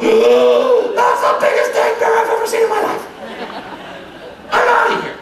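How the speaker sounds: high, loud comic shout of alarm; panicked bear. Acted. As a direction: yell it like a bear fleeing in mock terror. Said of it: "bear looks over and goes".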